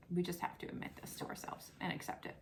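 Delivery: hushed, resigned confession; mock-grave hush; mock-solemn whisper. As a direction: whisper it like a guilty secret. Mock-grave resignation about Amazon.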